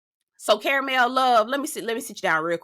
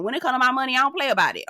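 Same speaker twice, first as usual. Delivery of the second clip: usual speaking voice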